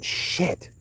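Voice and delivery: breathy under-the-breath curse, barely voiced; whispered curse. Real, not acted. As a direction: let it slip out as a soft whisper. Muttered curse while struggling with shell.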